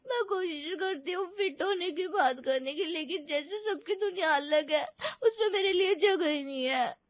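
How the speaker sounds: tearful, dejected confession; hurt child weeping to mother; sobbing. Acted. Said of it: "सिसकती हुई मीरा".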